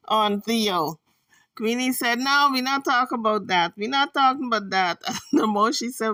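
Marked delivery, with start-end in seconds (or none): 2.2-5.0 louder, raised-pitch retelling of her objection; insistent refusal. Retold or imitated. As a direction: imitate her shutting the topic down, loudly.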